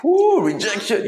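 loud, high, slow gloating cheer; gleeful whoop. Real, not acted. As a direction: cheer the rejection with delight. Gloating at rejection on show.

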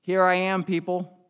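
high, loud proud call; proud donkey. Acted. Donkey struts into the market, calling out proudly. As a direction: strut in and announce yourself. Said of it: "he strutted right down the middle".

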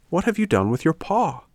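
high-pitched, curious question; inquiring puppet. Acted.

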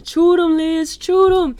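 high, loud call to the TV; family yelling at Swamp People from another room; yelling across the house. Retold or imitated. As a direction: shout it across the house at the screen. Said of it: "yelling across the house".

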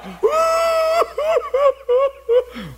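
loud, high wail imitating a sobbing man; long hammed-up crying howl; mock bawling. Preacher imitates the man crying on the floor, mock bawling. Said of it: "starts bawling uncontrollably".

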